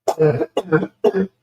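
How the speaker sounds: real coughing fit; harsh cough mid-stream. Real, not acted. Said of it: "I'm gonna cough".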